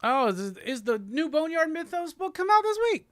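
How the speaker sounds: very high, rising excited question; high-pitched giddy anticipation. Real, not acted. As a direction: squeal it with climbing pitch. Excited discovery of new book.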